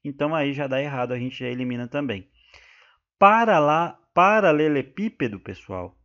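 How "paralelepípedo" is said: paralelepípedo said loudly, "pí" punched; loud, careful "paralelepípedo" with a strong "pí"; stress on PI. Shown as a demonstration. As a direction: hit the "pí" in paralelepípedo. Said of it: "tem como sílaba tônica aqui o pi".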